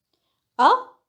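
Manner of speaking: "啊" with a steep questioning rise; asking for follow-up. Shown as a demonstration. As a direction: lift the pitch of "啊" to ask. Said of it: "expressing opinion or asking".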